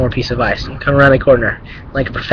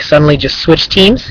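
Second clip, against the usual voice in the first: ordinary delivery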